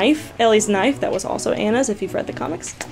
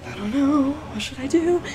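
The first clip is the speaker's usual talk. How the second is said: quietly talking herself into it, unsure; a soft, dithering inner voice; hushed nervous self-talk; 5 semitones higher, 7 dB quieter than usual. Retold or imitated. Starstruck fan hesitating near her idol, hushed and nervous.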